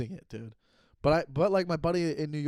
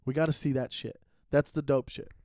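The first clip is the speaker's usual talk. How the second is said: usual speaking voice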